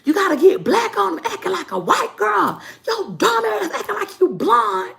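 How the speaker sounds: very high, squawking mother voice; scolding mom impression. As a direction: imitate an exasperated mom telling off her daughter. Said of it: "my mom would be like".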